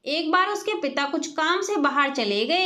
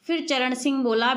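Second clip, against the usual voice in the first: ordinary delivery, nothing marked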